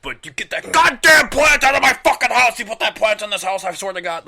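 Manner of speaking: over-the-top angry parent voice; mock furious parent. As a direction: build to a hammed-up screaming threat. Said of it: "As they're fucking sucking down".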